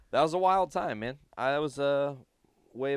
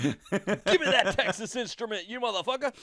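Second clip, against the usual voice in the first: high, louder comic outburst; mock angry demand; 9 semitones higher, 5 dB louder than usual. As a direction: jokingly demand it back, pitched way up. Riffing as angry sleep talker.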